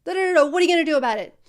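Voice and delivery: playing the irate customer, rattling off a demand; pretend angry caller. Coach plays an angry caller to a trainee, fast and loud. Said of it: "I'll pretend that I'm you".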